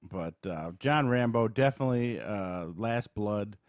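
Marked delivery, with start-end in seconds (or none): none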